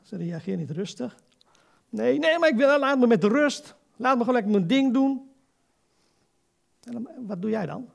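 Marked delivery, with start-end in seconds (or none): 1.9-5.3 higher, louder irritated mock protest; imitating a brush-off. Preacher imitating relatives who reject his message, higher louder irritated protest. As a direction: act out someone pushing the believer away. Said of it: "Ja, zo reageren ze dan".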